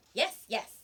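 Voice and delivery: put-on quick, loud eager yes from a boy; a child eagerly agreeing; eager promise. A narrator's reading. Little boy eagerly promising the fisherman. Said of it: "the little boy promised".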